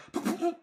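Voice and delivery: high choked cough and sputter; sputtering dragon breath. Acted. Game master imitating dragon failing to breathe, choked sputtering. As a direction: imitate a failed fire breath, coughing in bursts. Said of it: "it can't quite get a breath out".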